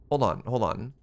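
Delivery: normal talking voice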